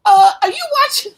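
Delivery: high, loud, excited check-in; loud and high, urging a friend to tune in; excited question. Retold or imitated. Messaging her co-hosts mid-stream, high loud excited question. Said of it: "I was like".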